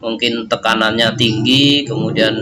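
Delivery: normal talking voice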